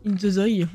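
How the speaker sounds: « Zone » redit « zoï », plus posément; répéter « [name] » en « in ze zoï »; titre mal lu. Real, not acted. (« Zone » finissant en oï au lieu de N)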